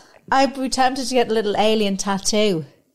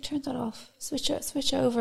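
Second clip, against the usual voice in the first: hushed order; 4 semitones higher, 11 dB quieter than usual. Retold or imitated. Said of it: "She's like".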